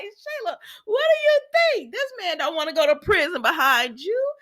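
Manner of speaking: very high-pitched mock scolding; amused incredulity. Viewer sets Sheila straight about Deacon, amused incredulity. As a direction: squeal it in playful disbelief.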